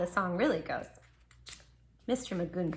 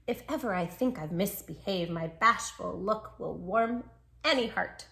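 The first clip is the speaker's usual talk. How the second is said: plain reading voice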